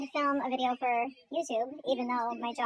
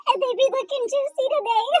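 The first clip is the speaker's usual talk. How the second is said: sassy; 10 semitones higher, 8 dB louder than usual. Pretend. Joking about swollen lips.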